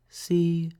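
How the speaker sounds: high gentle mother; lightly voiced reassuring mum; mother's voice. A narrator's reading. Mother reassures Franklin, lightly voiced. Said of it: "she would say".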